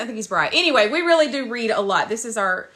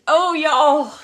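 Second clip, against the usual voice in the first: normal talking voice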